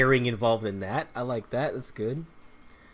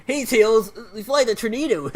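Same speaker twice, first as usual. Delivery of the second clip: higher, brighter cartoon-hero imitation; Sonic's opening voice; 6 semitones higher, 4 dB louder than usual. Gamer imitating Sonic flying the plane, high upbeat hero voice. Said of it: "He goes from, like".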